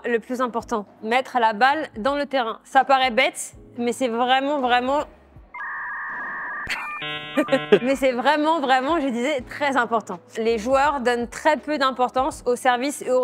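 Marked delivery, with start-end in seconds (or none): 5.5-7.8 high burst of laughter; laughing burst. Real, not acted. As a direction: break into a laugh. Laughing while filming a tip.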